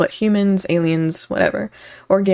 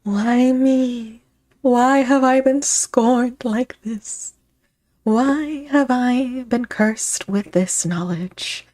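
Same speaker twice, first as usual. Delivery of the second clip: slow, anguished tragic-hero wail; melodramatic lament; 5 semitones higher, much slower than usual. Pretend. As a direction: mourn it like a cursed prophet. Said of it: "and I'm just like".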